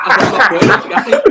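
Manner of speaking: high, loud mock outburst with laughter in it; mock-upset, laughing refusal; retold worked-up protest. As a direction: protest in hammed-up distress, half laughing. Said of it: "I was getting proper worked up".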